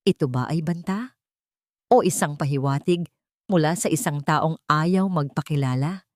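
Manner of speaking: plain reading voice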